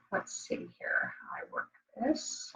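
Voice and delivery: very soft, whispered self-talk; muttering to herself. Real, not acted. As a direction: think aloud under your breath. Muttering to herself while fiddling.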